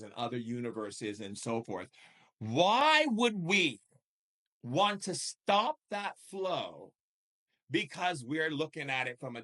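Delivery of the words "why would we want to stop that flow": louder, higher, drawn out very slowly; preacher pressing his audience, slow and loud; slow emphatic question. Real, not acted. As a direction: lean hard on every word to preach it. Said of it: "preaching emphasis".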